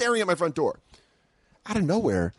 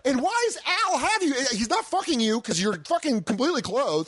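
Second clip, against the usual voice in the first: outraged; 11 semitones higher than usual. Retold or imitated. Outraged at stranger in apartment.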